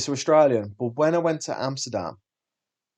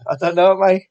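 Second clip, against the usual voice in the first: loud, high-pitched comic reply; mock-baffled exclamation; 8 semitones higher, 12 dB louder than usual. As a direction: exaggerate the helpless answer, loudly. Laughing through retold baffled reply.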